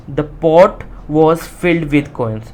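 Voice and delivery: slow, word-by-word reading; slow reading aloud. Shown as a demonstration. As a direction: dictate it to the class. Teacher rereads textbook line. (धीरे-धीरे पढ़ना)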